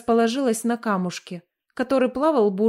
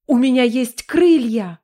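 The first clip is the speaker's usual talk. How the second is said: louder, thrilled cry; excited exclamation; 2 semitones higher, 6 dB louder than usual. Acted. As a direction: shout it out in delight. Discovers she can fly.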